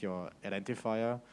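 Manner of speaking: ordinary delivery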